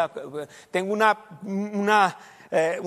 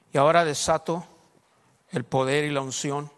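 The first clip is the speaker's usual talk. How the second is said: hushed, low retold prayer; 3 semitones lower than usual. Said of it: "dije, quoted prayer".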